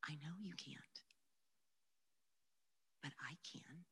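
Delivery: very soft, gentle unvoiced whisper; sweet whisper. The Lord whispering comfort to an overwhelmed girl, soft whisper. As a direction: breathe it tenderly like a comforting secret. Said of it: "this sweet whisper of the Lord".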